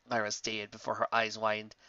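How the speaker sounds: usual speaking voice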